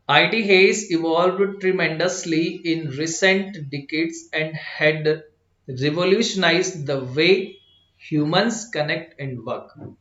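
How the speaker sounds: very slow read with a light pause before revolutionized. Shown as a demonstration. Teacher modelling the passage for students, slow with a short pause. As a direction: take a small breath before the long word revolutionized. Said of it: "मैंने हल्का सा pause लिया".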